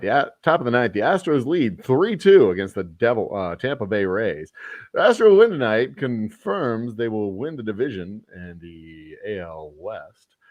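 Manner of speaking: mock play-by-play with big swings in pitch; baseball announcer voice. Host announcing Astros and giveaway news, baseball announcer voice. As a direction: call it like a radio baseball commentator. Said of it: "I'm gonna do this in my baseball voice".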